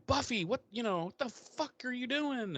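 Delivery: high-pitched flustered Giles, voice pushed way up; mock Giles. Friend mocks Giles yelling at Buffy, high panicked squeal. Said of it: "And Giles is like".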